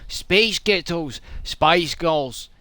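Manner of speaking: put-on punchy Scottish accent, trading retorts; arguing Scots. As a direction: argue outside an Edinburgh pub. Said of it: "sounds like an argument somewhere in, in Edinburgh".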